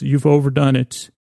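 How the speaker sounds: normal talking voice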